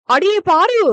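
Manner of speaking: high, loud call across the house; calling shout. Acted. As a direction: yell for the granddaughter. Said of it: "என கத்தினார்". (பேத்தியை கத்தி அழைக்கும் பாட்டி, உரத்த குரல்)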